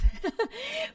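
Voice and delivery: a burst of high-pitched giggling; high laugh. Real, not acted. Laughs at her own note.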